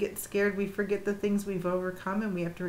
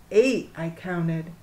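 usual speaking voice

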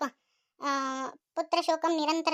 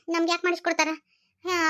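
usual speaking voice

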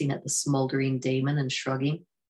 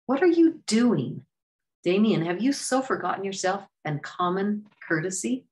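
indignant rebuke; 6 semitones higher than usual. A narrator's reading. Raja rebuking Damien, indignant. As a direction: scold him in disbelief.

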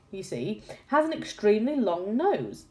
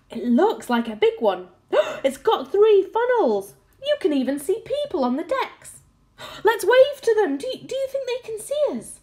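excited gasps; 13 semitones higher, 7 dB louder than usual. Acted. Children spotting a ship, gasping.

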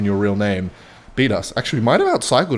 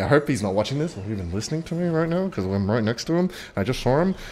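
hushed; 7 dB quieter than usual. Real, not acted. Hoping the neighbor can't hear.

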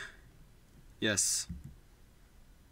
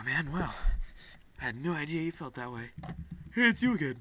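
normal talking voice

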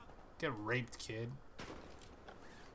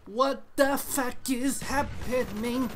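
slow, higher, drawn-out disbelief; baffled outburst; 9 semitones higher, 5 dB louder, much slower than usual. Real, not acted. Gamer baffled by the match, slow and high. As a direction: stretch it out in confusion. Confused by the game.

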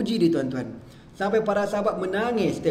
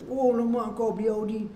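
impressed; 7 semitones higher than usual. Retold or imitated. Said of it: "dia kata".

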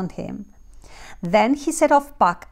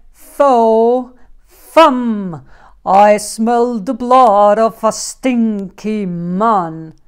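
booming louder giant voice, fee fi fo fum slow and heavy; giant's roar; 10 dB louder, slower than usual. Acted. Giant bursting in and roaring at the woman. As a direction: roar like a hungry giant sniffing out a man. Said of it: "in barged the giant".

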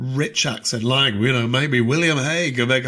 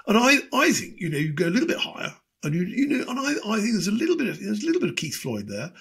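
higher, quick politician voice; David Cameron impression; 7 semitones higher, faster than usual. Politician making a point, higher and brisk. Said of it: "similar to David Cameron".

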